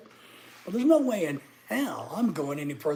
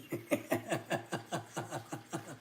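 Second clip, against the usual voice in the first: laughing; 8 dB quieter than usual. Real, not acted. Laughs at chat comment.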